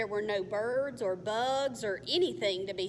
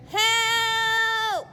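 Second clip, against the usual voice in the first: high, loud call for help; scream for help; 12 semitones higher, 9 dB louder than usual. Acted. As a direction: scream so someone hears you. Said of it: "Alex screamed".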